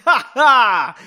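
loud, high "ha ha"; big fake laugh in character; acted laugh. A narrator's reading. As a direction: belt out a hammed-up laugh. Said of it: "he said, laughing".

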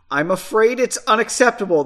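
usual speaking voice

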